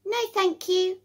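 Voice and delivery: high, small creature voice; squeaky little critter saying no. A narrator's reading. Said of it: "replies the little creature".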